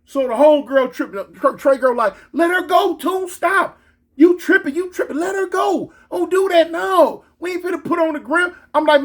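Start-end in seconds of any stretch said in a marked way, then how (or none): 2.3-8.5 high-pitched urgent protest; retold heated refusal; acted frantic scolding. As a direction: imitate a homegirl hollering to stop. Homegirl's acted scolding in quarrel.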